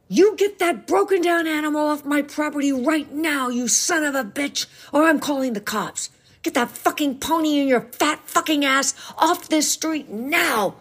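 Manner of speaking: high, strained angry rant, held back in volume; furious yelling. A narrator's reading. Father ordering Uncle Danny off his property, furious yell. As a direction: rant in fury with the pitch pushed up. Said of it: "yelled her father in his fury".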